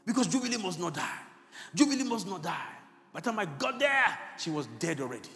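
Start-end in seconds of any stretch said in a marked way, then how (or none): 0.0-4.2 loud, raised, impassioned preaching; frantic retelling. Retold or imitated. Pastor racing to the dying girl, frantic and loud. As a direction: relive the desperate race at full voice.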